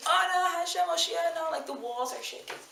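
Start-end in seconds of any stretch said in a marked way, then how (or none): 0.1-1.5 high, fervent shouted prayer; pitched-up mock scream of the whole crowd; congregation screaming. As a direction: cry it out like a packed shul shaking the walls. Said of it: "the whole shul starts screaming".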